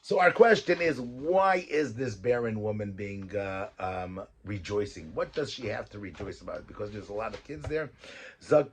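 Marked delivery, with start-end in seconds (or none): none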